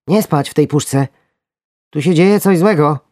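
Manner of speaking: high, loud panicky worm; alarmed worm. Acted. Worm warns sleeping friends of danger, panicky. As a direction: sound the alarm.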